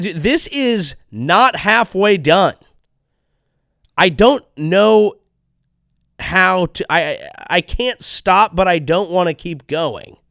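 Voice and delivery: mock dread. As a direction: sell faked overwhelm. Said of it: "This is how she feels".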